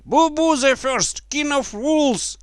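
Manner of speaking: very high, loud jeering cry; mocking shout. A narrator's reading. Someone in the crowd names the king, high mocking shout. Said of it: "Somebody shouted".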